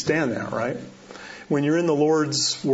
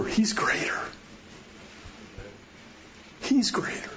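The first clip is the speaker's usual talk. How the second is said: hushed whisper; 5 semitones lower, 9 dB quieter than usual. Real, not acted. Pastor reminding the congregation, whispered. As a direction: whisper it, breathy and unvoiced.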